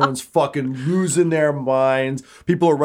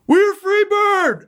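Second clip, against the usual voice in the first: very high, drawn-out holler; lone yell; 4 dB louder, slower than usual. Acted. Someone in the silent crowd, lone holler. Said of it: "And then someone just yells".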